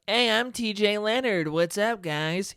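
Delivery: lightly voiced creepy vice principal; a hint of the odd vice principal greeting; vice principal's voice. Mimicking the new vice principal greeting the class, lightly put on. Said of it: "He talked like that, too".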